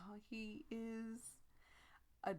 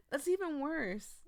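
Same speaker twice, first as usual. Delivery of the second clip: higher, louder, slowed protest; exasperated outburst; 7 semitones higher, 6 dB louder than usual. Real, not acted. Player reacting to Thalmo's bathroom idea, exasperated outburst. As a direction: protest in laughing disbelief. Reacting to absurd game line.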